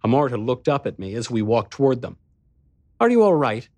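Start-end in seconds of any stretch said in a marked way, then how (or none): 3.0-3.7 high, sweetly concerned question. Acted. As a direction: ask gently, full of care. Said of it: "she said with sweet concern".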